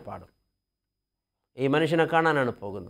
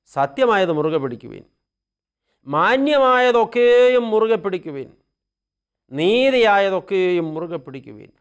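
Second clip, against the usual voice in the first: fervent preaching; 4 semitones higher, 6 dB louder than usual. Acted. Priest quoting Paul to the congregation, loud and exhorting. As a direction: proclaim each line like a call.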